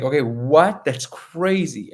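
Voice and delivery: high, drawn-out imitated amazement; retold disbelief. Fiancée suspects it was planned, retold disbelief. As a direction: react stunned, stretching the last words.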